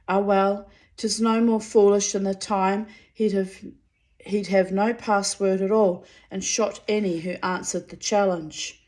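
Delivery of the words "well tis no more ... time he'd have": usual speaking voice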